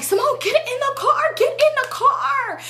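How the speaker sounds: high, drawn-out hammed-up shriek; dramatic mock yell. Friend urging Simone into the car, mock dramatic high yell. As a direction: imitate her overdramatic yelling, stretching the words. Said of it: "it was really how dramatic she was being".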